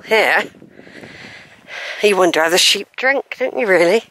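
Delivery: ordinary delivery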